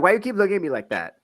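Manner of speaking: quick, high mock Cuban accent; fast put-on Cuban accent. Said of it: "She was Cuban".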